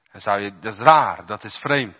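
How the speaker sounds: normal talking voice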